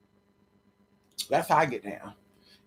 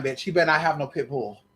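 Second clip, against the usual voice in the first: normal talking voice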